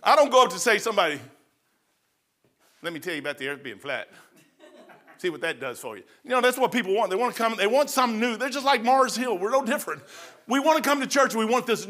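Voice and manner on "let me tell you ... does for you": lower, softer mocking voice; a low mock sales pitch for a new idea; mock preacher pitch. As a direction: sneer it like a gimmicky preacher.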